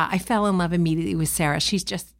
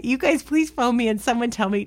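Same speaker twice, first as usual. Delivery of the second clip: ordinary talk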